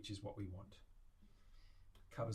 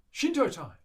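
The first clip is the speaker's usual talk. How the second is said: loud, high announcement falling away; gleeful call-out; 9 semitones higher, 16 dB louder than usual. Pretend. Maker calling out Willie's favourite part, gleeful shout. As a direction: shout it like a fanfare.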